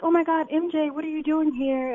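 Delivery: high-pitched acted surprise; Tyra Banks. Idol greeting the editor at a shoot, high mock surprise. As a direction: play a star greeting an unexpected friend.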